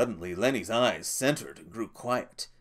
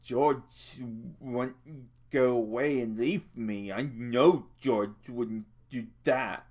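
miserable; 3 semitones higher, slower than usual. Acted. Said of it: "Lenny said miserably".

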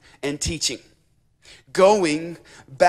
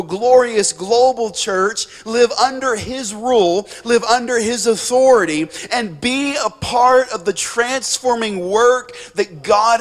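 raised, louder, driving exhortation; impassioned preaching; 4 semitones higher, 4 dB louder than usual. Acted. Preacher voices an apostle urging the crowd to join the kingdom, impassioned. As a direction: build urgent appeals phrase on phrase, pitch held high.

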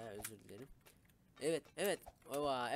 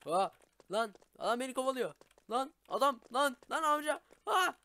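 high, loud panicked yelling; hammed-up panic, fleeing a chaser; being chased; 9 semitones higher, 8 dB louder than usual. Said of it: "Biraz rol yaptım arkadaşlar".

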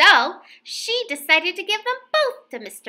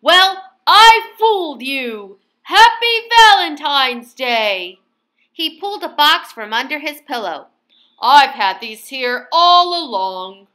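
proud Mister Bear; 3 semitones higher, 8 dB louder than usual. A narrator's reading. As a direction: savor the joke, boomingly.

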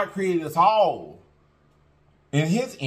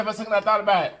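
ordinary talk, nothing marked

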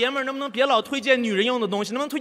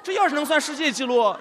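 normal talking voice

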